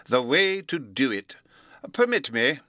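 slow, solemn, announcing like a title; chapter-heading voice. A narrator's reading. Professor announcing to Bruno like a chapter heading. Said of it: "in a voice like the heading of a chapter".

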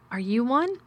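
a touch of fright, fully voiced; half-frightened. A narrator's reading. Girl asking the boy if he is one, half-frightened. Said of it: "her own whisper sounding half-frightened".

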